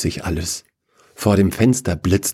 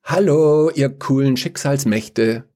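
calling out; 7 semitones higher than usual. A narrator's reading. Young woman hailing the council, calling out. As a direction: call it breezily through the door. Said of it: "steckt den Kopf zur Tür hinein und ruft".

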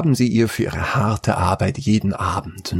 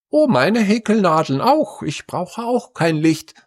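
second daughter's voice; 11 semitones higher than usual. A narrator's reading. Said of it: "sagte die zweite".

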